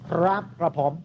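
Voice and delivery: slow, carefully articulated. Retold or imitated. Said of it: "เขาพูดไทยชัดมาก".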